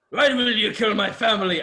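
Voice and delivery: a weak attempt at Shrek's voice; lightly voiced Shrek yelling at the killer; Shrek impression. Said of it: "I can't do a Shrek accent".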